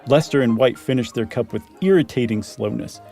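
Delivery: usual speaking voice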